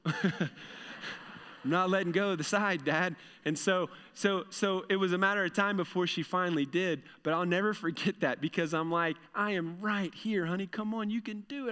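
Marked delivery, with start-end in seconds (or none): 0.0-3.2 put-on slow, giggly stubborn little girl; laughing child refusal. 9.3-11.7 gentle coaxing. Retold or imitated.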